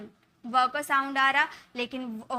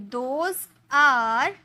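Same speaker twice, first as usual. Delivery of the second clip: slow clear model; 3 dB louder, much slower than usual. Shown as a demonstration. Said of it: "हम बोलेंगे".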